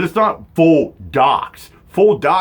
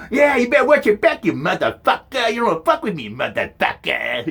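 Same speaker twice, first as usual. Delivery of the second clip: mock tough threat; 8 semitones higher than usual. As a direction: ham up a street-tough threat. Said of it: "The cat eye".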